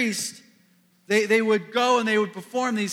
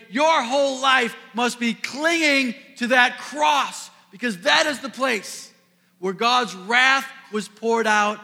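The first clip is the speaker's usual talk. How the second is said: louder, higher, driving the sermon home; preaching at full force; 6 semitones higher, 5 dB louder than usual. Acted. Pastor proclaiming the wonder of the cross, preaching at full force. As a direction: proclaim it to the whole room.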